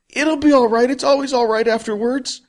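high, loud sobbing wail; crying. Acted. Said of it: "Jack started crying".

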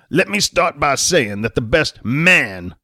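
"man" slowed and leaned on hard; slow, loading the word "man" with pointed weight; "man" stretched out as a smug jab; stress on man. Acted. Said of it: "he put a little too much emphasis on the word man".

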